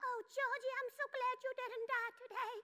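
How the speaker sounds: high, soft mommy falsetto; put-on high, gentle mom; Mommy's voice. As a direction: coo it in a tiny, high mother voice. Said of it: "well, she sounds like this".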